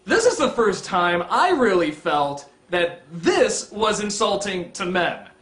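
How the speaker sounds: ordinary talk, nothing marked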